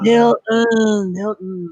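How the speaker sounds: high-pitched mock lament; imitating a dawning, dismayed reaction; Harley Quinn. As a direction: draw the name out high, falling away. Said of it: "I love Harley's reaction to Milton".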